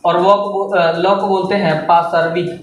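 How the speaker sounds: normal talking voice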